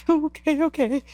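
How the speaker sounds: high, whimpering retold agreement; mock tearful. Climber still crying after guide's reassurance, mock tearful. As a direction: give in through imitated tears. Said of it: "with all that emotion, all the tears".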